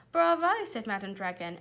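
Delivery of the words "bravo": high, loud cheer; loud approval. A narrator's reading. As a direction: applaud the pupils. Teacher applauding the plan.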